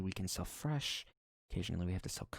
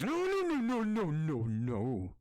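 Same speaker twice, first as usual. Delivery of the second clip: frantic refusal; 2 semitones higher, 4 dB louder, slower than usual. Acted. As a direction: panic then calm down. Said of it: "she takes a deep breath and says".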